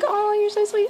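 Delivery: high, cooing mock thanks; imitated sweet gushing. Singer thanks fan for presents, in a high sweet coo.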